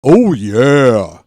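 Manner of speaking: booming loud mascot bellow; big loud cartoon pitchman cry; Kool-Aid Man. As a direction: burst through the wall shouting it. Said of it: "My Kool-Aid Man impression".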